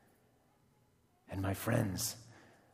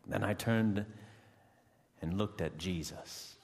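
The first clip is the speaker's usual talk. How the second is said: ordinary delivery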